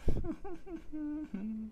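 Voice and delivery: soft high giggle; despairing high laugh; high-pitched laugh. Real, not acted. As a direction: laugh helplessly, high and quiet. Laughs after saying wants to cry.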